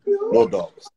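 plain reading voice

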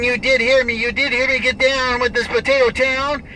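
ordinary talk, nothing marked

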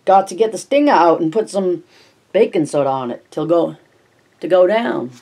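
put-on old Maine neighbour, broad down East accent. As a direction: lay on the down East Maine accent heavier this time. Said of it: "so thick with down East accent".

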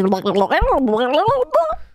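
warble like a cartoon alien; alien noise. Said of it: "alien noise".

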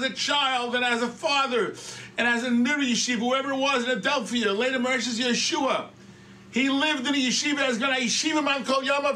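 high, sustained sermon delivery; impassioned preaching. Real, not acted. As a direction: preach it out with rising force. Preaching at raised pitch.

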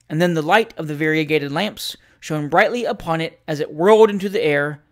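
plain reading voice

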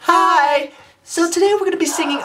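high, quick put-on intro.

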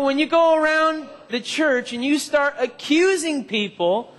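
ordinary talk

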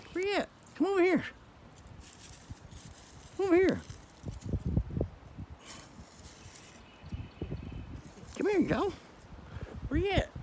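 squeaky high voice for the goats; high pet talk. Real, not acted. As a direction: coo up high like talking to babies. Coaxing goats to come over.

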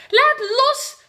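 very high, loud demand; shout. Acted. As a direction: yell to be let go. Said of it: "schreeuwt giraf".

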